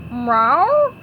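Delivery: a small puzzled meow; snail meow. Acted. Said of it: "asked Gary".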